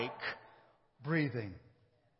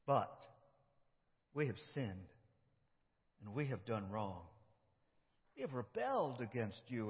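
contrite hush; 8 semitones lower, 10 dB quieter than usual. Acted. Confession in prayer.

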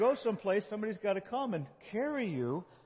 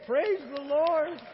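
joyful praise; 10 semitones higher, 7 dB louder, slower than usual. Real, not acted. Celebrating the healing.

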